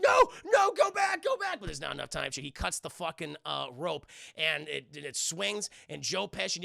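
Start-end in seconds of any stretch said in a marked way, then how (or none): 0.0-1.6 high, loud frantic pleas; panicked shouting. Retold or imitated. Burglars on the rope about to be cut, panicked shouting. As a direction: yell in hammed-up alarm.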